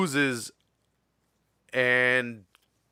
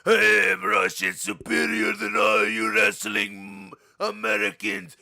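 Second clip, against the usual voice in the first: put-on slow, heavy Russian accent, ending much higher; Russian accent impression; 8 semitones higher, slower than usual. Mimicking Nikita Koloff's boasts to his friend, thick Russian accent. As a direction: boast slowly like a Russian heel. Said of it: "he talks like this".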